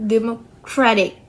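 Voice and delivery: "democratic" broken up, landing firmly on "cra"; stress on "cra". Shown as a demonstration. Showing the stress shift from democracy, "democratic" stressed on "cra". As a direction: split "democratic" and stress the third syllable. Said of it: "but the stress shifts to ra".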